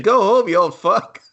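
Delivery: high, loud mock heckle; a mock insult shouted up high; mock movie line. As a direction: yell it like a rude movie extra. Mocking a movie scene.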